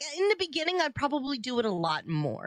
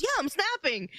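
put-on proud child voice; 10 semitones higher than usual. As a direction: mimic a pleased little kid. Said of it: "He goes".

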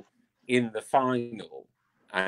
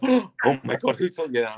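imitated scandalised reaction, raised a little; mock shock; 4 semitones higher, 5 dB louder than usual. Imitating a dancer asked about a bad word, mock shocked. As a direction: act out someone appalled by a rude word.

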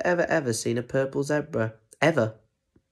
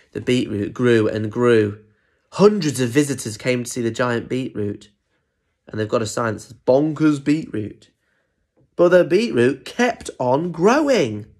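story narration; 4 dB louder than usual. A narrator's reading. Adult telling children of the growing beetroot, story narration. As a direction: build up the wonder, stretching key words.

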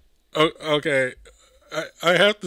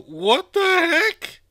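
high, slow, louder disbelief; stunned what the heck; 10 semitones higher, 6 dB louder, much slower than usual. Real, not acted. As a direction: drag it out in shock. Shocked at the door.

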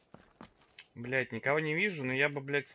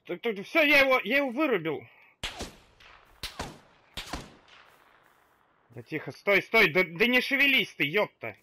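loud high triumphant urging; excited shout; 7 semitones higher, 7 dB louder than usual. Real, not acted. After a kill, urging silence.

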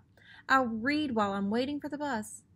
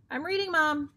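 put-on exasperated kid; 6 semitones higher than usual. A narrator's reading. Repeated refusal, growing.

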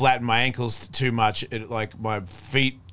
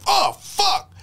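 a sudden much higher yelp of panic; panicked yelp; 3 dB louder than usual. Retold or imitated. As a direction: squeal it like a startled tripper. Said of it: "You go".

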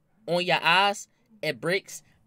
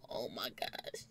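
hushed awe; 9 semitones lower, 16 dB quieter, slower than usual. Retold or imitated. Reliving the escape.

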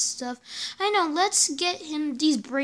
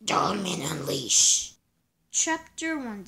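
ordinary delivery

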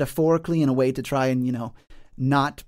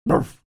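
a single loud mock bark; loud dog bark; 7 dB louder than usual. Mastiff barks at the trespassers, one loud mock bark. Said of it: "And the dog next to him".

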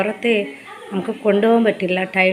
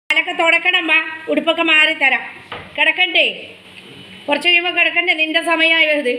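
pet talk; 6 semitones higher than usual. Real, not acted. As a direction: owner cooing to her dog that wants to go out. Tending to dog.

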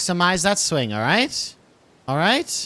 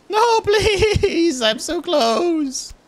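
high, laughing desperate please; high giggling begging; 12 semitones higher, much slower than usual. Real, not acted. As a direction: beg through laughter. Begging at the edge.

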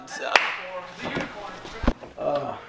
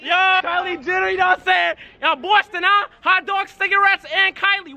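hyped shout. Acted. Hyped shouting about Kylie.

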